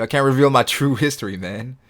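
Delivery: usual speaking voice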